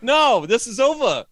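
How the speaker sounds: loud, high, dismissive mock outburst as God; mock God snapping. God brushing off the libs, loud and curt. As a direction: bark it out like an annoyed deity.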